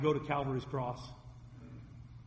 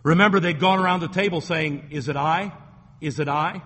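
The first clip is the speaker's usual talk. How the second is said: loud, driving pulpit delivery; louder preaching, reading scripture to the church; full-force preaching; 8 dB louder than usual. Acted. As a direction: thunder the verses out to the congregation.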